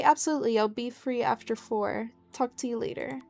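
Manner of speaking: ordinary talk, nothing marked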